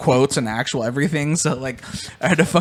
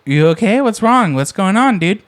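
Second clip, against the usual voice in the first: mock concern; 6 semitones higher, 4 dB louder than usual. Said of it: "but I was like".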